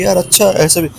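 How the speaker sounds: high, amazed "really, like that?"; mock surprise. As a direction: feign amazement at the offer. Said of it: "मैंने कहा". (नकली हैरानी)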